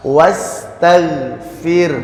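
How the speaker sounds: WASTAGHFIR slowly, vowelless R trailing into an H-like breath; sukun ending. Shown as a demonstration. Teacher showing the pause form, breathy sukun ending. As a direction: draw out WASTAGHFIR and end R on a puff. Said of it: "ada he-nya gitu ya".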